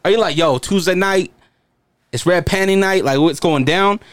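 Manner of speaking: normal talking voice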